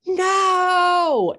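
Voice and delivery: sudden high, loud cry of no; wife screams no at her husband, high and loud; retold scream. Wife screaming no at husband after his confession, high loud cry. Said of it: "I screamed".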